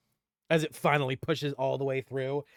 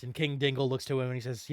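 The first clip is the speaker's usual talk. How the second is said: ordinary talk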